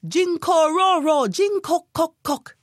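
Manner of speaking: high chanted toad croak; little toad calling back. A narrator's reading. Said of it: "he called, like all toads do".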